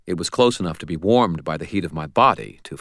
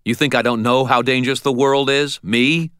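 angry retort; 8 semitones higher, 5 dB louder than usual. Acted.

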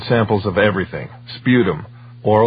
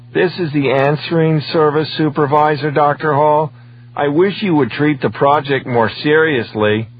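raised, louder male official's voice; heavy supervisor; 7 semitones higher, 4 dB louder than usual. A narrator's reading. Supervisor scolding Doctor Hall, heavily. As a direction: reprove firmly, pitched up and projected. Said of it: "said the supervisor heavily".

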